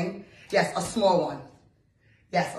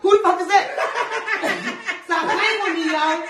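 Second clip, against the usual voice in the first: laughing mock anger; 9 semitones higher, 6 dB louder, faster than usual. Girlfriend confronts him laughing.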